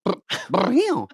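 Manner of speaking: repeated high whirring drill bursts; drill sound effect. Acted. Guessing the drill guy's tool, drill noise. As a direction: buzz like a power tool, by mouth. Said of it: "what would he sound like".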